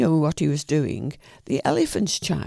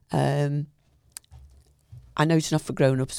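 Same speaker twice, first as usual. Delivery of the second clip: plain reading voice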